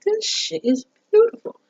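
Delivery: very high-pitched, awed delight; gushing admiration. Retold or imitated. Speaker fawning over the dream kitchen gadget, gushing. Gushes over the pasta arm.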